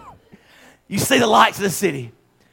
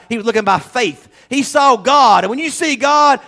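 ordinary delivery

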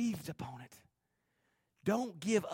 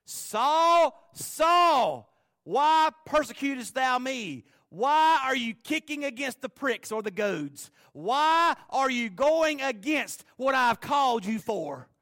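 booming, higher and louder call from heaven; God calling Saul on the Damascus road, booming and slow; 7 semitones higher, 8 dB louder, slower than usual. Acted. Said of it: "a voice spoke".

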